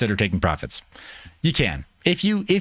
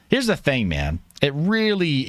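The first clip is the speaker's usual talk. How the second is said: ordinary delivery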